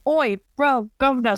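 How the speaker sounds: a hammed-up British voice; British accent. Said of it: "the accent is playing in my head".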